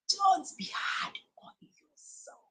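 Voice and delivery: tearful, breaking plea; on the verge of tears. Real, not acted. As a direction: plead through real tears. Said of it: "I feel like crying".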